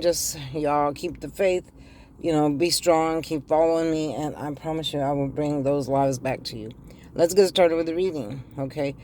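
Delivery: ordinary talk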